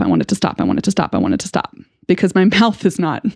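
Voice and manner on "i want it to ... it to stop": much lower, croaky repeated plea; stuck repetitive plea. Shown as a demonstration. Reliving her meltdown, a low stuck repeated plea. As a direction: drone the same words like a broken record. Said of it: "what's coming out of my mouth is".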